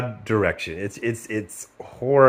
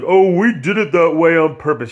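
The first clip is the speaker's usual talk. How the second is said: higher, louder mock director excuse; smug director; 6 semitones higher, 6 dB louder than usual. Mocking the director's excuse, higher and louder. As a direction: sneer it as a defensive director. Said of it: "that fucking director is like".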